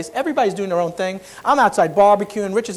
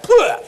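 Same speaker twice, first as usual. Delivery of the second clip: loud, high sputter of suppressed laughter; sudden mock splutter; burst of laughter; 12 semitones higher, 7 dB louder than usual. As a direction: imitate a laugh exploding out. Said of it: "I went".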